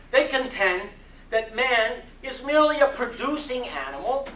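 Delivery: measured preaching cadence with rising emphasis; sermon reading. Acted. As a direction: deliver it from the pulpit, building conviction. Sermon continues after response.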